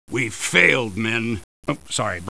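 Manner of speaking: low, soft admission of defeat; subdued, downcast sergeant; dejected. Acted. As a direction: let the commander's voice sink in defeat. Admitting defeat to the troops.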